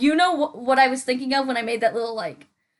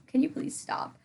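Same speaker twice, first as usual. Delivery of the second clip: whispered plea; 8 dB quieter than usual. Pretend. Pleading aside to imaginary sir.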